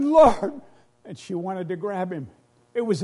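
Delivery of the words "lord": sudden loud high cry of recognition; Mary cries out as she recognizes him; joyful cry. Retold or imitated. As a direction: call out Lord in overwhelmed joy, much higher and louder. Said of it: "And she said".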